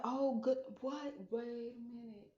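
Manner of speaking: startled realisation, retold; retold surprise. Reliving her surprised realisation.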